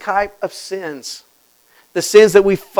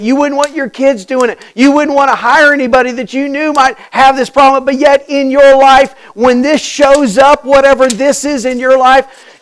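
louder, driving sermon build; preaching at full force; 8 semitones higher, 10 dB louder than usual. Acted. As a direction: press it home to the congregation.

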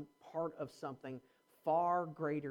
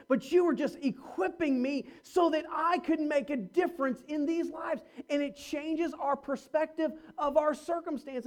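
louder, driving sermon voice; rising preaching; 8 semitones higher, 7 dB louder than usual. Acted. Pastor urging the congregation, in full preaching voice. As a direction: preach it to the back row.

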